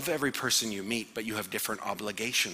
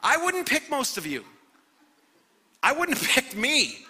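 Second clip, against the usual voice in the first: doubting angels; 5 dB louder than usual. Acted. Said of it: "the angels of God are like".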